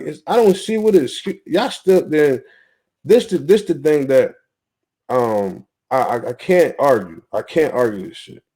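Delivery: usual speaking voice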